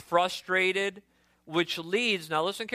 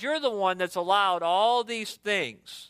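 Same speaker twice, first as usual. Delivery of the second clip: ordinary talk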